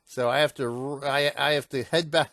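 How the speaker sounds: ordinary talk, nothing marked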